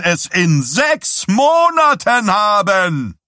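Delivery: a candidate preaching his program to a roaring crowd; rabble-rousing preacher. A narrator's reading. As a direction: whip the crowd up, stretching the punchline. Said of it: "aufpeitschende Sing-Sang-Stimme eines Erweckungspredigers".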